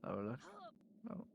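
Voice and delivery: usual speaking voice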